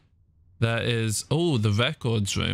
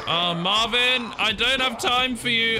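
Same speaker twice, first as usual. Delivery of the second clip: exasperated whine; 10 semitones higher than usual. Real, not acted. Gamer with no time for Marvin, high exasperated whine. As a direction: whine it high at the one in your way.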